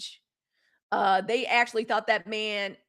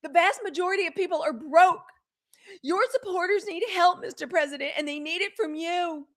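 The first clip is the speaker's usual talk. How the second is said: higher, hammed-up pleading pundit; acting her out; 8 semitones higher than usual. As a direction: plead dramatically to the president, mocking. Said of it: "You like the acting".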